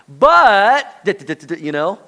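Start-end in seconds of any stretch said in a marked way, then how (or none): 0.1-0.9 "but" drawn out high and very loud; excuse-making "but". Shown as a demonstration. Preacher shows an excuse erasing an apology, loud stretched "but". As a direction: hit "but" hard and stretch it. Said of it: "you've just erased the apology".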